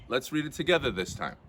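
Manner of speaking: ordinary delivery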